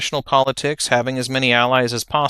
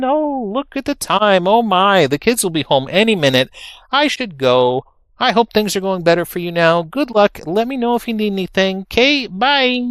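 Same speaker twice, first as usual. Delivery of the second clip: flustered hurried goodbye; 9 semitones higher, 5 dB louder than usual. Retold or imitated. Rushing to escape awkward call.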